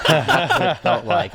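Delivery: real laugh breaking through the words. Real, not acted. Telling friends about the scare, through real laughter. Laughing at his own story.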